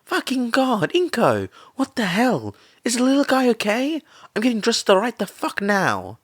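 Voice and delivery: a friend panicking and scrambling to get dressed; alarmed outburst, high and loud, speeding up; panicked. A narrator's reading. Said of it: "Mitsuki panickingly questioned".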